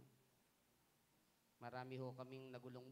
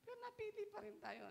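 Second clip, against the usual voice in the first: amazed exclaim. Real, not acted. Preacher marvels at grace.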